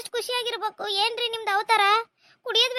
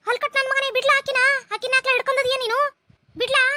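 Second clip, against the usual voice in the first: furious scolding; 5 semitones higher than usual. Acted. Parent yells at the son to release his wife. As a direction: yell at the son to let her go.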